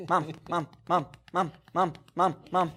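lightly mimicking a whiny child repeating mom; a hint of a pestering kid tugging at a parent; nagging child.

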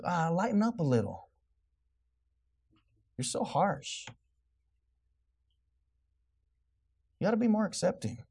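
lightly put-on effeminate objector; sarcastic effeminate voice. Preacher imitating critics who find him harsh, sarcastic effeminate voice. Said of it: "using that effeminate voice".

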